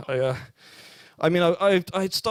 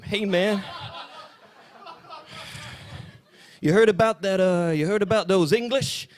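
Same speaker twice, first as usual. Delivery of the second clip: higher, hammed-up American street slang; mock American jive voice; 6 semitones higher than usual. Comic jive voice aimed at a heckler in the crowd. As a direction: play a cartoon American hipster, much higher. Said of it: "it's a crazy-ass jive".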